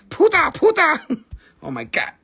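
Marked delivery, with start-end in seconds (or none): 0.1-1.1 puta, puta squeaked up high and loud; playful high-pitched puta; high mock puta. Shown as a demonstration. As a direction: chirp puta twice in a high voice. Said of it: "You see?".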